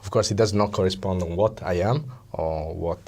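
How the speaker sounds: normal talking voice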